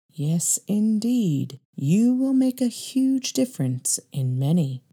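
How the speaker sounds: slow, soft, otherworldly attendant; quiet, mysterious prophecy. Acted. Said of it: "quietly says".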